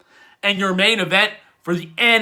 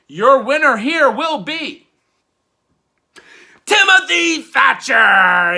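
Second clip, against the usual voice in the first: louder, higher announcer call; winner call; 7 semitones higher, 6 dB louder than usual. Pretend. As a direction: sell the winner to the crowd. Announcer-style prediction call.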